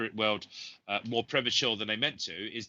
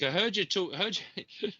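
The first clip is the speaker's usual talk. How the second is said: higher-pitched common South London voice; South London accent; 8 semitones higher than usual. Retold or imitated. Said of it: "in a very common South London accent".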